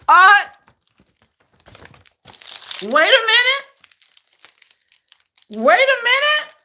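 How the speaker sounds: high, loud dawning astonishment; high-pitched rising double take; real surprise. Real, not acted. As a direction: stop short in thrilled disbelief, voice shooting up. Spots the album while unboxing.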